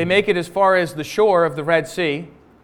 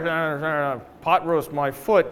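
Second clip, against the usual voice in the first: lower, soft sulky grumble; grumbling mock; 4 semitones lower, 4 dB quieter than usual. Hangry person grumbling about food, soft sulky grumble. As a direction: mutter it like hangry complaining. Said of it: "you're like".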